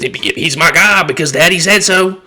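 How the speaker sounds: mock dim voter. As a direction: sneer like a sheep repeating orders. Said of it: "these idiots really just said".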